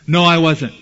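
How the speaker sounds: a sudden loud, defensive no; loud denial. Acted. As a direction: throw the denial back at her. Peter denies the accusation.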